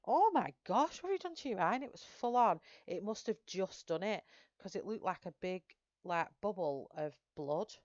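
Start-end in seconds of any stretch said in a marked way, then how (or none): 0.1-1.8 much higher and louder, alarmed gasp of a question; retold shocked outburst, high and loud; shocked exclamation. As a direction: shriek it like you just saw his eye. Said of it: "and I went".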